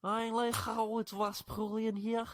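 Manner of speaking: high, slow hammed-up Scouse; bad Scouse accent. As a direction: badly fake a Liverpool accent, drawn out and high. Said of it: "a bad Scouse accent".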